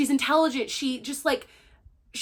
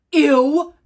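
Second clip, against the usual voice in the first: loud, high grossed-out ew; loud disgusted ew about a villain; 4 semitones higher, 9 dB louder than usual. Real, not acted. Disgust at Pollux.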